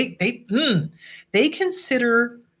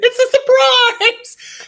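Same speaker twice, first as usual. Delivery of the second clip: much higher, louder and slow "It's a surprise"; sing-song tease; 9 dB louder, much slower than usual. Acted. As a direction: stretch it out like a playful secret. Teasing viewers about next topic.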